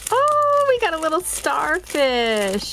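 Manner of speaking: high squeal easing down into a coo; delighted cooing. Real, not acted. Host finds a little starfish toy, delighted high coo.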